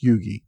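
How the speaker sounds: Yugi as YOO-gee, without the H; correct surname pronunciation. Shown as a demonstration. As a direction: say Yugi with a hard G, ending in "ee". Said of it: "it's pronounced".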